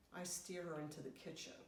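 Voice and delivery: usual speaking voice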